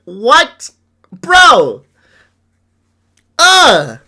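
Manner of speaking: loud, high bursts of disbelief; exasperated outburst. Real, not acted. Reacting to hidden sponsorships admitted, exasperated outburst. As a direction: let each word burst out, exasperated.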